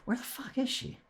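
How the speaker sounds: high, quiet impatient mutter under the breath. Retold or imitated. Waiting for the speech therapist, quiet impatience. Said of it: "I'm just like".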